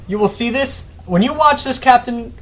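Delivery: excited call-out. Real, not acted. Calling out to the viewer.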